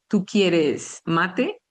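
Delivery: "¿Tú quieres mate?" said slowly and evenly, each word spaced; slow demo. Shown as a demonstration. Teacher showing students the tú form, slow and clear. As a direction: spell out "¿Tú quieres mate?" slowly for Spanish learners. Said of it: "en vez de decir".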